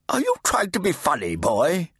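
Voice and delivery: put-on high, demanding deaf old priest; fat Redeemer. A narrator's reading. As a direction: bark at the boy to speak up.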